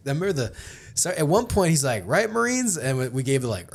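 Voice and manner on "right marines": raised, high barking call to the troops; sergeant major call. Retold or imitated. Sergeant major calling out to the silent company, raised and high. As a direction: play the sergeant major rallying his Marines.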